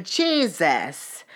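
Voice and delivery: long, drawn-out disbelief; real exasperation, slow and emphatic; exasperated exclamation. Real, not acted. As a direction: stretch it out, overwhelmed. Exasperated sum-up of the ordeal.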